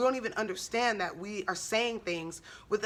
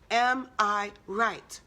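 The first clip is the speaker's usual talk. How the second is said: drawn out word by word, "Am... I... right"; slow, deliberate repeat; 3 dB louder, much slower than usual. Real, not acted. Speaker urging listeners to question themselves, slow and deliberate. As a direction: weigh each word of the question very slowly.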